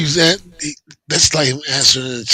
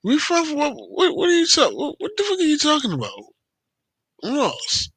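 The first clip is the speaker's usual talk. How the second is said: high-pitched, disbelieving outburst; can't believe what he's hearing, voice shooting up; incredulous; 13 semitones higher than usual. Real, not acted. Listener stunned by the story.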